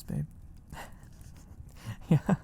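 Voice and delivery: ordinary delivery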